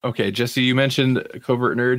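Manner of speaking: usual speaking voice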